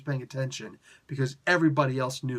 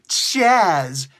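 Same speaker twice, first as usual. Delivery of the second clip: "Chaz!" yelled out loud; shouted Chaz; 3 semitones higher, 11 dB louder than usual. Shown as a demonstration. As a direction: bellow the name "Chaz!". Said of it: "not Chaz".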